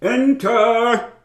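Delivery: high booming theatrical call; grand command. Acted. Actor summoning the nervous visitor in, grand booming call. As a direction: bellow it like a stage tyrant. Actor booms from dressing room.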